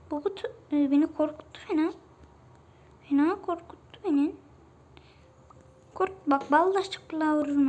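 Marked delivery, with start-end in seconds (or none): none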